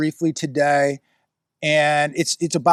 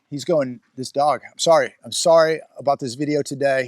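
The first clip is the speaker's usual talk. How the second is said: usual speaking voice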